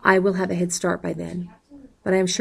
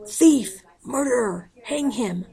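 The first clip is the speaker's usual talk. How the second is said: yelling accusations; 6 semitones higher, slower than usual. A narrator's reading. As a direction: hurl each word at him in a rage. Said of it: "she yells".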